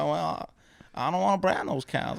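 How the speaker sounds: put-on reluctant Texan boy. Little Jimmy answering Aunt Sally, meek Texan. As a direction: meekly answer back in a Texas accent. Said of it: "And I was like".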